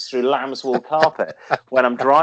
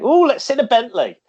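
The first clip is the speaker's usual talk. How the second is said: much higher and louder, thrilled; excited high voice; 11 semitones higher, 5 dB louder than usual. Retold or imitated. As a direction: gush like an eager tourist. Retold own excited suggestion.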